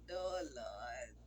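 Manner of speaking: real tearful groan. Real, not acted. Overcome while recalling husband.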